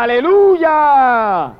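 slow, ringing praise shout; shouted hallelujah. Acted. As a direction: call it out like a multitude. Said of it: "y gritaba".

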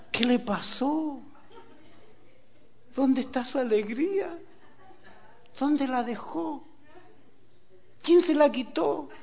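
high, slow, drawn-out questions; plaintive questioning. Acted. Preacher asking a bitter woman about lost joy, plaintive. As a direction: plead gently, stretching each question.

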